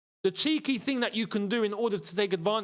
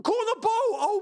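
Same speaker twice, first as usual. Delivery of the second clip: excited shouting; 4 dB louder than usual. Real, not acted. The chance continues.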